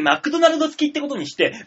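normal talking voice

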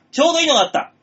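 theatrical discovery. Acted. Finds fortune column, theatrical.

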